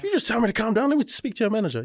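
high, loud demanding caller; angry customer. Retold or imitated. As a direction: play the furious Karen caller. Angry caller quoted in story.

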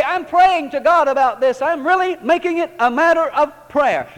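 mock sanctimonious "prayer" talk; mock pious churchgoer. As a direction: parody the busy believer's excuse.

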